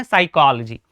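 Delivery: साइकोलॉजी with silent p; right pronunciation. Shown as a demonstration. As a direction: start on s, no p, in साइकोलॉजी. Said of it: "इसको क्या पढ़ते हैं". (साइकोलॉजी, 'प' चुप रखकर)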